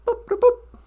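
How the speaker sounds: high, chirpy mouth-made boops; computer beeps. Pretend. Playing holodeck, imitating computer beeps. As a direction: beep like a sci-fi computer.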